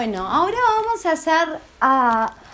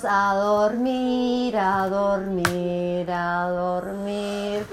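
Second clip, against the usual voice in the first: slow, lower and softer, fading to sleep; drowsy lullaby fade; sleepy voice; 8 semitones lower, 6 dB quieter, much slower than usual. Shown as a demonstration. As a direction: drift off like a sleepyhead. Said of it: "¿cómo dormimos?".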